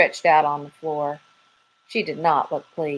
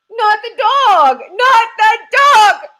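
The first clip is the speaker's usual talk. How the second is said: much higher, louder, slow panicked yell; squirrel yelling; 9 dB louder, slower than usual. Acted. Squirrel panicking at the thought of the dog, yelling high and loud. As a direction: shriek it like a terrified squirrel. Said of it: "yelled the squirrel".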